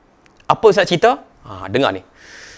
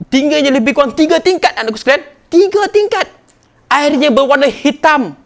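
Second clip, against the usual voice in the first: rising sermon fervour; 8 semitones higher than usual. Acted. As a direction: drive home the danger to the congregation. Tsunami account at rising force.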